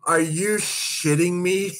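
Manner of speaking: slow, high disbelief; disbelieving. Retold or imitated. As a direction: ask in stunned disbelief. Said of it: "I go".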